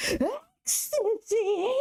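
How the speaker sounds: very high, slow horrified squeal then gasp; hammed-up shocked mom. Mom hearing the drug's name, high mock horror. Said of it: "she starts freaking out".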